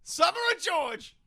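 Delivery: high loud panicked cry; panicked shout. Acted. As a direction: yell after them in panic. Mock panic producers leaving.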